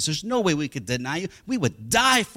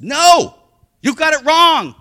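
loud, high insistent no; loud denial; 12 semitones higher, 14 dB louder, slower than usual. Acted. Peter denying the girl, loud and high. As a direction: shout him down. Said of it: "He says".

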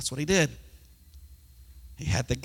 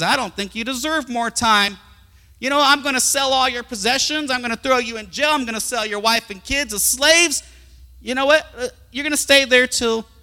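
loud, high acted royal threat; stern threatening king; 8 semitones higher, 7 dB louder than usual. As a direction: lay down the punishment forcefully. King refuses and threatens the debtor.

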